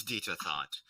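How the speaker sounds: ordinary talk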